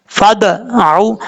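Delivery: slow फदअू with a bouncing echo on द; with qalqala. Shown as a demonstration. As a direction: let the द bounce back. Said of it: "ये कलकला है". (कलकला के साथ फदअू)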